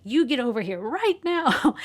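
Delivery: imitated friend's command. Friend summoning her crying friend, imitated urgent command. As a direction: order them over, pitch swooping up. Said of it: "she's like".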